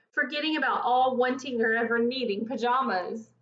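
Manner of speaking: plain reading voice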